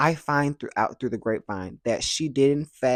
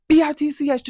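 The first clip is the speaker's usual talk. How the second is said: yelled insult; 14 semitones higher, 9 dB louder than usual. Retold or imitated. Uncle yelling insult.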